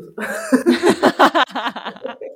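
long, high peal of laughter; hearty laugh. Real, not acted. Partner admitting she acted surprised, laughing.

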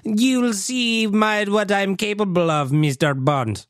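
a hammy Russian spy taunting Mister Bond; Russian spy villain. Retold or imitated. A host mocks an Arnold impression as a Russian spy villain, slow drawn-out accent. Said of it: "like a Russian spy".

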